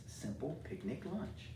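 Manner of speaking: normal talking voice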